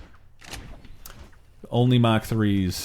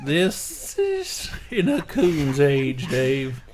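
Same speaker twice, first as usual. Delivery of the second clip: slow cowboy with a whistle; Slim Pickens; 4 semitones higher, much slower than usual. Pretend. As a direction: whistle the s sounds, slow and high. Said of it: "I'm trying to whistle when I say it".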